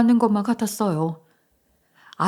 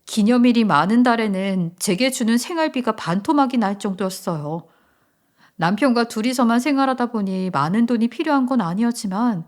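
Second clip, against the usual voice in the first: plain reading voice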